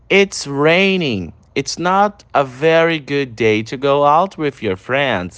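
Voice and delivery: slow, deliberate English, each word apart; slow teacher demo of the line; slow pronunciation model. Shown as a demonstration. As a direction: model it slowly for students to repeat. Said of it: "repete a pronúncia em todos".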